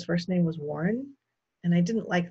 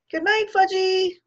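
put-on high, loud, slow singsong child voice; toddler echo; 8 dB louder, slower than usual. A narrator's reading. Toddler waves good night to everyone, piping loudly. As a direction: parrot it like a small child.

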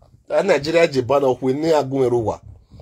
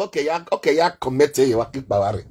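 ordinary delivery

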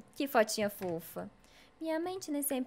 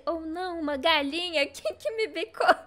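higher-pitched hammed-up alarm; mock fear; 5 semitones higher than usual. Streamer pretends fear of a pecking chicken, mock alarm. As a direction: play at being scared, voice rising. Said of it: "ficando com medo".

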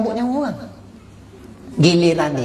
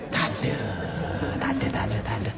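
whispered; 10 semitones lower, 11 dB quieter, much faster than usual. Shown as a demonstration. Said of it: "whispered after watch stops".

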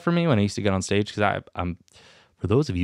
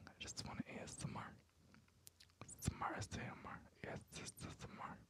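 barely audible, voiceless whispering; ASMR whisper; 4 semitones higher, 27 dB quieter, faster than usual. Pretend. Podcaster indulging in ASMR for the whole thing, whispering. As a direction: whisper into the mic for tingles. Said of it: "we're done with the A S M R".

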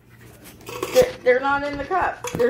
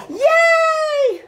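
excited cheer; 4 dB louder than usual. Real, not acted. Cheering a find too soon.

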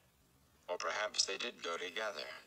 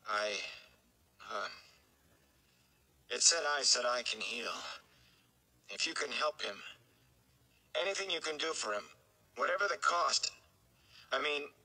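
put-on higher, pleading young man's voice, close to tears; anxious young Rand; 5 semitones higher than usual. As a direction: beg the Aes Sedai for help. Rand stammers his plea.

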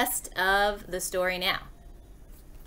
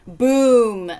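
big, drawn-out crash word; sudden storybook crash; loud boom; 2 semitones higher, 9 dB louder than usual. A narrator's reading. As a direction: boom it out loudly.

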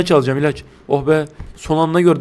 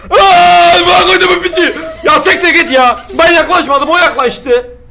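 high, loud protesting shout; terrified yell; 12 semitones higher, 7 dB louder than usual. Real, not acted. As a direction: yell in fright and outrage. Attacked, screaming.

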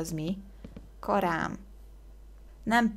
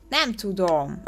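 words breaking into a giggle; real amused exasperation; laughing helplessly; 3 semitones higher, 4 dB louder, slower than usual. Real, not acted. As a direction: give up with a laugh. Gives up laughing at own failure.

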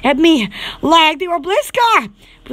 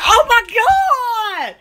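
drawn-out gasp; 6 semitones higher, much slower than usual. Retold or imitated. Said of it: "I was like".

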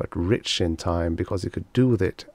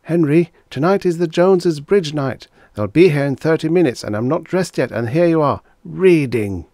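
higher and louder, scolding wife; wife scolds Henry for reading before the guests arrive; Agnes nagging; 8 semitones higher, 7 dB louder than usual. Acted. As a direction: hurry him along, exasperated. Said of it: "Agnes continued".

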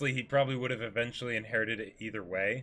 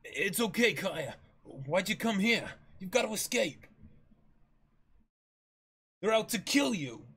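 put-on higher, pressing young man's voice; Usopp, urgent warning; 6 semitones higher than usual.

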